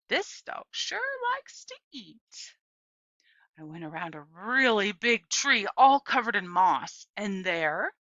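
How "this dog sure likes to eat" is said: put-on high, slow old woman's voice. A narrator's reading. Gloria speaking to Opal from the garden, slow. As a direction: draw it out like a kind old lady.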